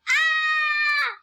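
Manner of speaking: high, loud, sustained acted scream. A narrator's reading. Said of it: "She screamed so loud like this".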